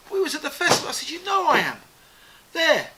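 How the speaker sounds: high, loud retold protest; indignant insistence. [name] insisting Peter knows him from the festival, indignant. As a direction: press him to remember you.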